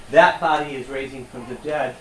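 usual speaking voice

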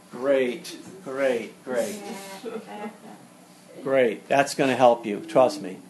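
dry, low sarcastic "great"; deadpan mock approval. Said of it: "I'm being facetious right now".

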